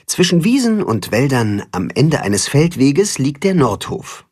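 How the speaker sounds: usual speaking voice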